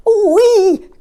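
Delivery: a squeal while sent tumbling; tumbling cry. Acted. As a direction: crocodile flung through the air, squealing. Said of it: "cried the crocodile".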